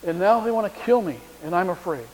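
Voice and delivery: a whiny, fearful complaint; whining. A narrator's reading. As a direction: complain like a frightened man. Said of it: "Elijah has the same response".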